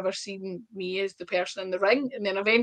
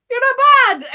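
a much higher, much louder mock coach verdict; 11 dB louder than usual. Lionheart telling the trainee she's not bad, hammed-up mock voice. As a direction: squeal it out like the gruff coach grudgingly approving.

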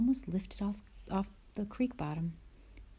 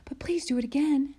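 breathless, much higher pleading gasp; Laura gasping; 8 semitones higher, 6 dB louder than usual. Acted. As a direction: gasp out a plea to Pa. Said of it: "Laura gasped".

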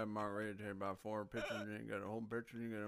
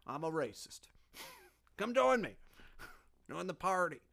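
louder, higher hammed-up good old boy; mock good old boy; 5 semitones higher, 8 dB louder than usual. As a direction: sell it like a cheery host. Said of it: "good old boys".

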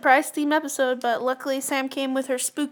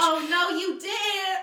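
shocked outcry; 6 semitones higher, slower than usual. Real, not acted. Reacting to the insult.

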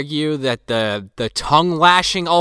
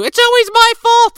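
put-on whiny kid; 8 dB louder than usual. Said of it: "then that turns into".

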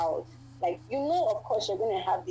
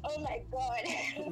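soft, drawn-out, slightly higher gasp of disbelief; retold awe; 4 semitones higher, 6 dB quieter, much slower than usual. Woman retelling her reaction to a proposal, softly awestruck. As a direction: breathe it out slowly in quiet amazement. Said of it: "And we're like".

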